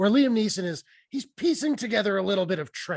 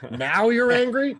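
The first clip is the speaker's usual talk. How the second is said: higher, louder and slow, drawn-out mock question; mocking disbelief; 7 semitones higher, 6 dB louder, slower than usual. Host mocks the hero's late anger with slow disbelief. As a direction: stretch it out in amused disbelief.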